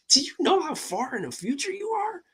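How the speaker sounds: replaying his own amazed words to her, high up; excited retelling. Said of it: "I'm like".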